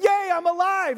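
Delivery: high, loud hammed-up "yay"; mock-excited cheer. Lazarus just resurrected, high mock-excited cheer.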